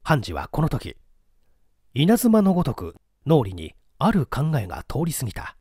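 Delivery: usual speaking voice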